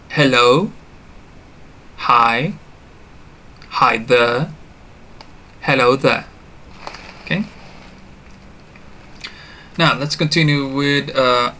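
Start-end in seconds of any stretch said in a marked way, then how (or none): 0.1-6.3 "Hello", "Hi", "Hi there" said singly with clear pauses; pronunciation model. Shown as a demonstration. Teacher reviews greetings for learners, careful pronunciation model. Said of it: "Let's review the pronunciation".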